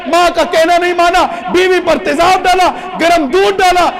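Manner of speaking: high, loud impassioned preaching; fiery preaching. Acted. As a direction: hammer home each sin to the congregation. Preaching at full force.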